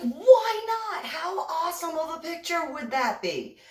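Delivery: high-pitched, gushing enthusiasm; eager persuasion. Retold or imitated. Model talking photographer into a risky picture, eager. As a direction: sell the idea with giddy excitement.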